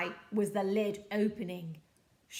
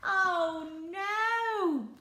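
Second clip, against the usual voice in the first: mock dismay; 8 semitones higher, 5 dB louder than usual. A narrator's reading. Ironic ending for troll.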